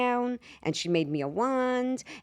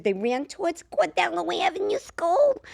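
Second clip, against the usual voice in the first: put-on small child voice; 10 semitones higher than usual. Child answers the cops.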